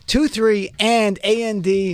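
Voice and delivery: "A N D" spelled letter by letter, slow; spelled out slowly. Shown as a demonstration. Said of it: "my Jersey accent".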